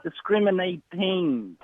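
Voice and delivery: drawn-out, very slow, each syllable spelled out; slow correction. Shown as a demonstration. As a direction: say it slowly, as if correcting a mistake. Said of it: "It's called discriminating".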